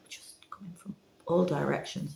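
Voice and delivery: hushed marvel at the puppies; whisper. Real, not acted. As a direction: whisper it in awe. Awed recap of puppy swarm.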